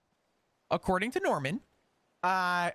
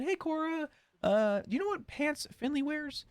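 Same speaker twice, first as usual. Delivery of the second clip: much higher, lightly disguised voice asking about pants; put-on phone voice; 9 semitones higher than usual. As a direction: pitch way up like someone else calling. Calling Finley's girlfriend Cora.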